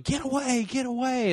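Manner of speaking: high-pitched mock yell at ordinary volume; imitated yelling. Bystanders yelling at rescuers by a wrecked car, imitated high-pitched yell. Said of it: "people are yelling".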